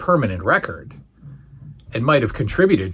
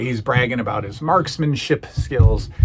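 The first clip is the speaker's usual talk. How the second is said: usual speaking voice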